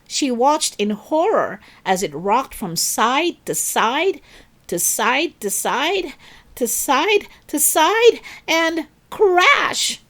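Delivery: rising tension as the vase rocks; building excitement to crash. A narrator's reading.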